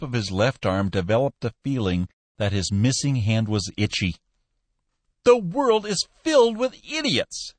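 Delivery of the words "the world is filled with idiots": high, raised ranting outburst; a mild, high-pitched furious rant; raving furiously. Acted. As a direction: rave at the world, furious. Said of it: "he raved furiously".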